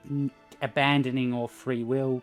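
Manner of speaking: ordinary delivery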